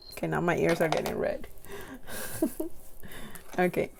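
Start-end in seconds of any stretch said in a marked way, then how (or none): 1.5-3.5 soft breathy giggle; giggle, flustered; embarrassed giggle. Real, not acted. Ears going red, soft embarrassed giggle.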